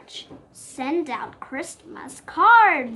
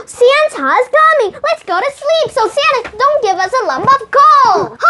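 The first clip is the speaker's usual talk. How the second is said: high, loud, fast excited warning; much higher and louder eager urging; excited hurry; 11 semitones higher, 10 dB louder, faster than usual. Acted. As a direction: rush everyone to bed before Santa. Excited bedtime warning about Santa.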